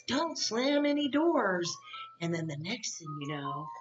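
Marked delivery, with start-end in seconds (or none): 0.1-1.7 higher, slow mock yell; retold yell. Kevin yelling at the crew, slow mock yell. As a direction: call it out like Kevin telling them to stop slamming doors. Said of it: "Kevin even yells".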